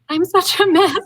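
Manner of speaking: high laughing self-mockery; laughing at herself. Retelling her breakdown to partner, high laughing. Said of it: "I was like".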